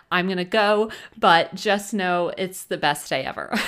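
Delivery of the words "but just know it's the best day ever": ordinary talk, nothing marked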